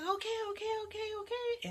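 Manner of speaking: high, loud hurried okays; impatient. Acted. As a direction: brush her off quickly. Daughter answers impatiently.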